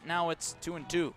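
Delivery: normal talking voice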